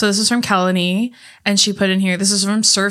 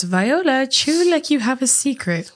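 quoted movie line; 5 semitones higher than usual. Retold or imitated.

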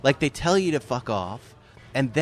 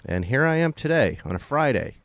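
lower, relaxed host storytelling; podcast intro read; 5 semitones lower than usual. Acted. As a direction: set the scene for listeners like a host. Host's podcast intro segment.

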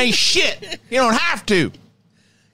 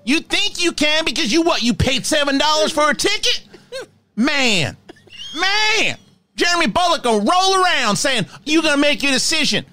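higher, ranting troll voice, insulting the fan; Professor Crow rant; 4 semitones higher than usual. Pretend. Professor Crow berating a Star Wars fan, higher ranting voice. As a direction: tear into him like a mean cranky professor. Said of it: "Professor Crow needs its own Patreon".

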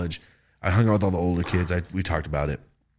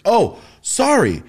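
mock sorry; 11 semitones higher, 7 dB louder, much slower than usual. People apologizing mockingly at party.

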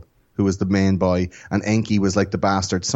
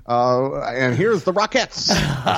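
higher, put-on American TV host; New Year's host impression; 6 semitones higher than usual. Hosting a Nibiru New Year's Eve broadcast, put-on showman accent. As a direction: welcome viewers to the big countdown show. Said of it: "listen to you do accents".

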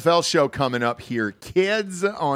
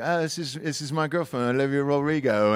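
put-on British accent; 4 semitones higher than usual. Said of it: "talking like that".

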